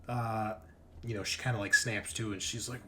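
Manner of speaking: ordinary delivery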